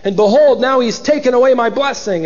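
high, acted bitter complaint; bitter cry. Son complaining to his father, bitter cry. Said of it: "exceedingly great and a bitter cry".

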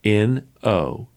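ordinary talk

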